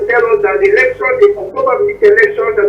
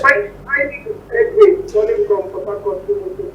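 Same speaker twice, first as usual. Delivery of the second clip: faint phone line; 5 semitones lower, 5 dB quieter than usual. Real, not acted. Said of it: "You're very faint".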